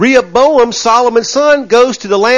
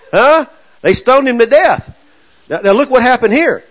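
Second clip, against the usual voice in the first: usual speaking voice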